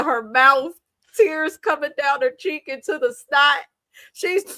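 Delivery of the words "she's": giggling through the words; can't hold the laugh in. Real, not acted. Host cracks up retelling the scene, laughing.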